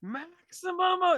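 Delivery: loud, very high, drawn-out imitation scream; screamed catchphrase. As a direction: belt it out slowly in a high scream. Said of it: "that's how he says it".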